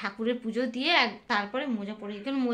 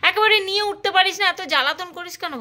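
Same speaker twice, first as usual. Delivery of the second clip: exasperated scolding; 6 semitones higher, 7 dB louder than usual. Real, not acted. Mother scolding her child for pestering her, exasperated and loud.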